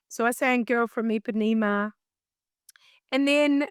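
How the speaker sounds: usual speaking voice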